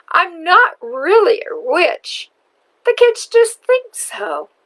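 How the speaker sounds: put-on an old woman admitting she's no witch. Witch speaks kindly now.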